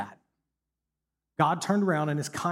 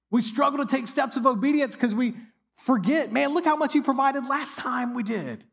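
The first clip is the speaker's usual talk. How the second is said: rising, louder preaching build; preaching at full force; 6 semitones higher, 4 dB louder than usual. Real, not acted. Preacher driving home forgetting God's mercy, fervent and rising. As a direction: climb it to a fervent peak.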